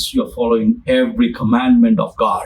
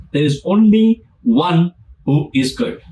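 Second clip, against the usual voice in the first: normal talking voice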